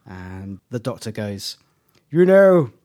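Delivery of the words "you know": big, booming, raised actor's voice; loud, high-set declamation; Tom Baker. Retold or imitated. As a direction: announce it loudly in a theatrical impersonation. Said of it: "this is Tom Baker's voice".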